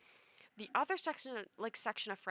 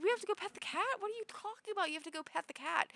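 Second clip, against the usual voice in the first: incredulous; 11 semitones higher, faster than usual. Retold or imitated. Dreamer incredulous at friends.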